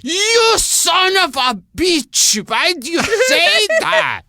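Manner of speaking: mock furious shout in an accented older woman's voice, high and loud; slow, high, laughing yell of outrage. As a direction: bellow it like an outraged mother-in-law, slowly. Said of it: "She'd go".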